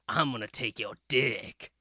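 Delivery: a hint of a low, creepy old man. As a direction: lightly voice the dream's old man. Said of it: "He said it like".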